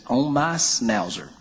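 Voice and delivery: drawled slowly in a Southern country voice; slow, matter-of-fact country punchline; slow country deadpan. Retold or imitated. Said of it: "she talked real slow and she was real country".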